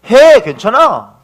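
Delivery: slow, loud, warm go-ahead; reassuring encouragement. Retold or imitated. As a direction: tell him it's fine, drawn out and bright. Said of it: "긍정적으로 답변을 내렸어요". (느리고 힘 있게 격려하며)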